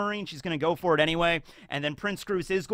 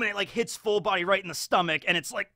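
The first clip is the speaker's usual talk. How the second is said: ordinary delivery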